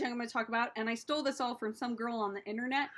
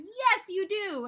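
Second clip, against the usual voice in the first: emphatic answer; 6 semitones higher, slower than usual. Retold or imitated. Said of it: "My answer is".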